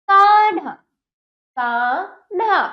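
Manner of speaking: कान्हा drawn out, kaan-haa; correct half न. Shown as a demonstration. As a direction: lengthen का, then join न to हा in कान्हा. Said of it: "कान्हा का उच्चारण शुद्ध है".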